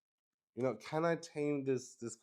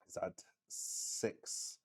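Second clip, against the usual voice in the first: whispered; 3 semitones lower, 11 dB quieter, much slower than usual. Real, not acted. Reading the result to herself.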